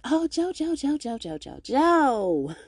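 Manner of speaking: high, eager mock call of Joe's name; hammed-up gleeful name calling; excited calling. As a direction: gush over Joe like a delighted fan.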